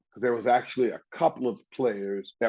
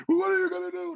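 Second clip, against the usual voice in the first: much higher mocking challenge; taunting coach; faster than usual. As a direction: goad him into a fight. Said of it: "And he goes".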